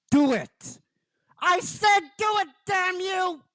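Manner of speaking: high-pitched screamed demand; desperate yelling. Acted. Crying Ranger dares Goldar to strike, desperate high yell. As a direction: scream the order, pitch climbing sharply. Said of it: "she's still yelling at him".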